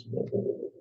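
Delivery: whispered to himself in alarm; a frightened whisper, almost no voice; whispering in panic at what he has done; panicked whisper. Retold or imitated. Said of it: "He thinks".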